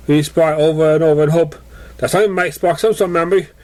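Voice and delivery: plain reading voice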